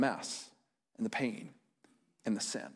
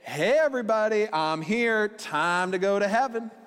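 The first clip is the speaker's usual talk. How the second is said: higher, louder, mock-cheery call to everybody; mock breezy announcement; 5 semitones higher, 3 dB louder than usual. Preacher mocks a wrong idea of Jesus, breezy and loud. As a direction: play up a chirpy false Jesus. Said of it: "Jesus does not say".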